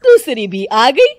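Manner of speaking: put-on loud, scornful older woman; grumbling mother-in-law. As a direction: scoff at the lazy daughters-in-law.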